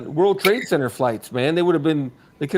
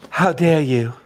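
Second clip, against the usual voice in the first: mock gasp; slower than usual. Mock offense at park preference.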